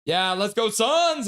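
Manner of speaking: high, loud, slow "let's go Suns"; hammed-up fan cheer; mock cheer. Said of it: "says Big Mac".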